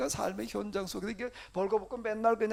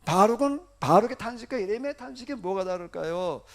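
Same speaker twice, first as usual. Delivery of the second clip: usual speaking voice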